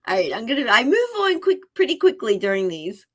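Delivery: ordinary talk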